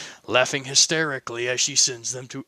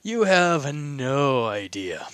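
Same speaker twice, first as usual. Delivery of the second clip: slow ominous repeat; much slower than usual. Acted.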